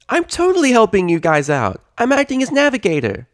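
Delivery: loud, high indignant filly voice. Acted. Sweetie Belle defending her navigating, high and loud. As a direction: insist you're useful.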